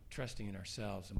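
ordinary delivery

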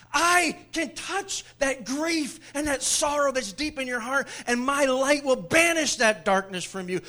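loud, high, impassioned voicing of Jesus; full-force preaching. Preacher booming as Jesus to the congregation, loud and impassioned.

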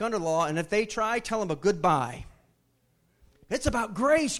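high, loud fervent preaching; impassioned pulpit climax; preaching at full force. Real, not acted. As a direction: preach it out hard to the congregation.

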